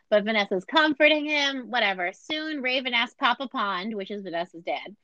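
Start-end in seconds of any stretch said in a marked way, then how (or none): none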